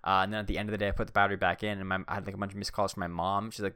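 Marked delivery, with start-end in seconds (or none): none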